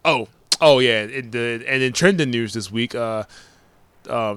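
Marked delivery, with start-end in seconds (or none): none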